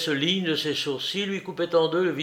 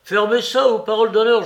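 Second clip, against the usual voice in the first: higher and louder furious threat; raised angry yell; angry shout; 8 semitones higher, 8 dB louder, faster than usual. A narrator's reading. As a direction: bark the order at the old man. Larrier threatens Soupe.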